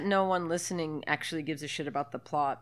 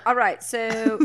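louder, higher mock-British; bad British accent; 4 semitones higher, 6 dB louder, slower than usual. Recapping the film in jest, mock British accent. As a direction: carry on in a put-on British accent. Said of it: "try to talk in British accents".

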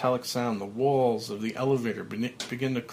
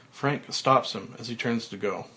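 plain reading voice

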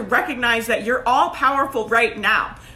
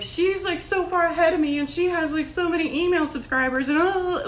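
envious self-doubt; 5 semitones higher than usual. Retold or imitated. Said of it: "we all do it, right? Where it's just like".

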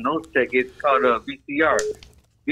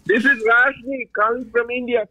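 put-on Indian accent; 7 semitones higher, slower than usual. Prank caller faking Indian accent.

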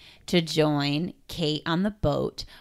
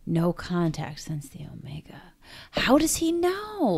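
hushed, unvoiced whisper, slowing at the end; mysterious whisper. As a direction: whisper it like a puzzling secret. Mysterious repeat of quoted line.

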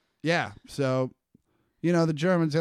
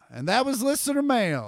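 announcer sign-off; 7 semitones higher than usual. Shown as a demonstration. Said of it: "will you say".

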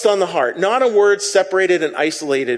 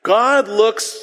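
ordinary talk

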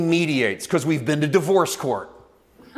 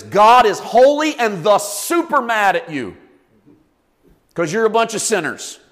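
usual speaking voice

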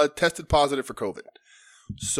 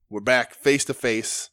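ordinary delivery

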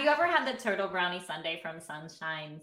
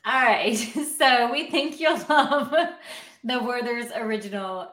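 laughing; 4 semitones higher, 7 dB louder than usual. Real, not acted. Laughs while talking.

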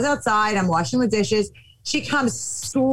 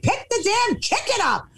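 exasperated order; 9 semitones higher than usual. Retold or imitated.

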